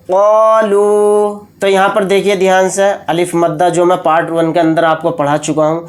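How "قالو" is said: قالو with قا and لو each held long; slow even قالو; both syllables stretched. Shown as a demonstration. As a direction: draw out قا then لو evenly. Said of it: "قاف اور لام دونوں کو کھینچ کر پڑھیں گے".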